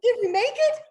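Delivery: very high, loud, eager repeated what; frantic questions. Retold or imitated. Daughter begging her mother for the news, frantic. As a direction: pester for the answer, on tiptoe.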